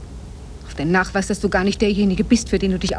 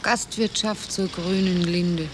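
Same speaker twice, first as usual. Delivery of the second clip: slow reading aloud; 2 semitones lower, 6 dB quieter, slower than usual. Acted. Wife reads tavern sign aloud, slow and puzzled. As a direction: spell out the sign slowly.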